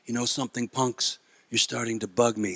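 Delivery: low, soft tough-guy threat; Clint Eastwood. Acted. Comedian imitating a film hero warning punks, low quiet menace. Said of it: "He would just say something like".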